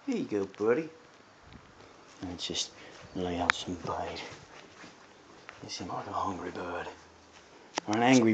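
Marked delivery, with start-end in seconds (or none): 0.1-6.9 soft, low coaxing voice; coaxing a wild bird. Real, not acted. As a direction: hush and tempt it with food. Softly luring with food.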